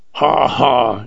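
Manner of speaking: lightly acted sarcastic "ha, ha"; sarcastic laugh. A narrator's reading. Jim scoffing at the old plainsman, mildly sarcastic. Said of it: "shouted Jim sarcastically".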